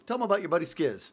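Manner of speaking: normal talking voice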